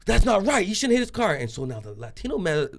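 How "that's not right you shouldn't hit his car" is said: high-pitched indignant protest; retold anger, pitched well up; getting mad. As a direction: imitate an upset pastor objecting. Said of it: "He ends up getting mad".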